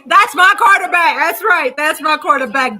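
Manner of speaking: loud triumphant praise; proud cheer. Real, not acted. Fan celebrating Dak after the win, loud proud cheer. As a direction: boast about your quarterback.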